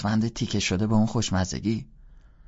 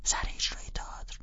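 little sister offering, hushed; whisper; 7 dB quieter than usual. Acted.

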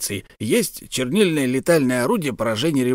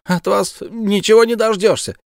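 высокий громкий крик; выкрикнуть с досадой, гораздо выше; 11 semitones higher, 5 dB louder than usual. Acted. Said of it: "закричала птичка". (птичка кричит, высоко и громко)

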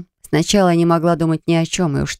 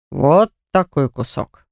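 slow, tempting male voice; slow sly coaxing; slower than usual. A narrator's reading. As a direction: drag out the bribe slowly. Xavier shows big chunk.

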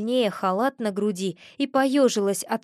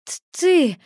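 higher, louder stuttered start; stammering; 7 semitones higher, 5 dB louder than usual. A narrator's reading. Girl challenges the unseen knocker, stammering. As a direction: stumble on the first sound. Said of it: "заикаясь, начала я".

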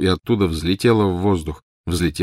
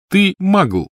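high, loud name-calling; contemptuous; 13 semitones higher, 6 dB louder than usual. A narrator's reading. Snape insulting the non-magical sister, contemptuous. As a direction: throw the word as an insult. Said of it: "тон Снегга говорил сам за себя".

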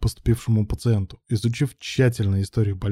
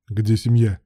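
controlled, brief question; restrained tone; slower than usual. A narrator's reading. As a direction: hold the feeling back and ask evenly. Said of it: "старался говорить сдержанным тоном". (сдержанным тоном)

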